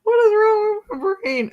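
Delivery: high, amused self-mockery; giggly disbelief. Real, not acted. Host laughing at her own odd guess, high and amused. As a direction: laugh at your own silly idea.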